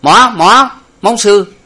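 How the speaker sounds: very high, loud mock French cry; shouting in French. A narrator's reading. Lục Tặc yells broken French from outside, high and loud. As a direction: holler it from outside. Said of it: "thì la lên rằng".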